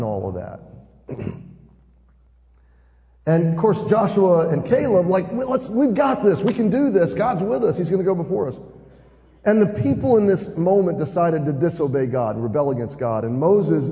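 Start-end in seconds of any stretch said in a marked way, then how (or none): 5.3-8.6 higher-pitched, eager pep talk; rally the doubters, imitating an eager believer; acted can-do urging, pitched up; confident rallying. Acting out the confident two spies.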